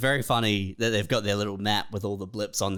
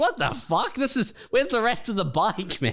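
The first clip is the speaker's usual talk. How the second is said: high, baffled outburst; mock baffled; 9 semitones higher than usual. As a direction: play the stunned worker finding only the GPS. Confused scooter company workers.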